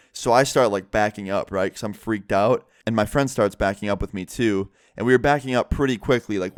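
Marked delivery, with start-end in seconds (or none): none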